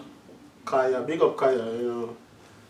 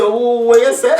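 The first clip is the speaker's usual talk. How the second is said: higher, louder stammer through laughter; 7 semitones higher, 7 dB louder than usual. Retold or imitated. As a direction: stumble on the first word, laughing. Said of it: "And when him stammer".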